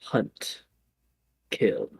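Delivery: quiet, low single-word commands; different villain voice. Acted. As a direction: give each word quietly, with a pause between. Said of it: "a different voice goes".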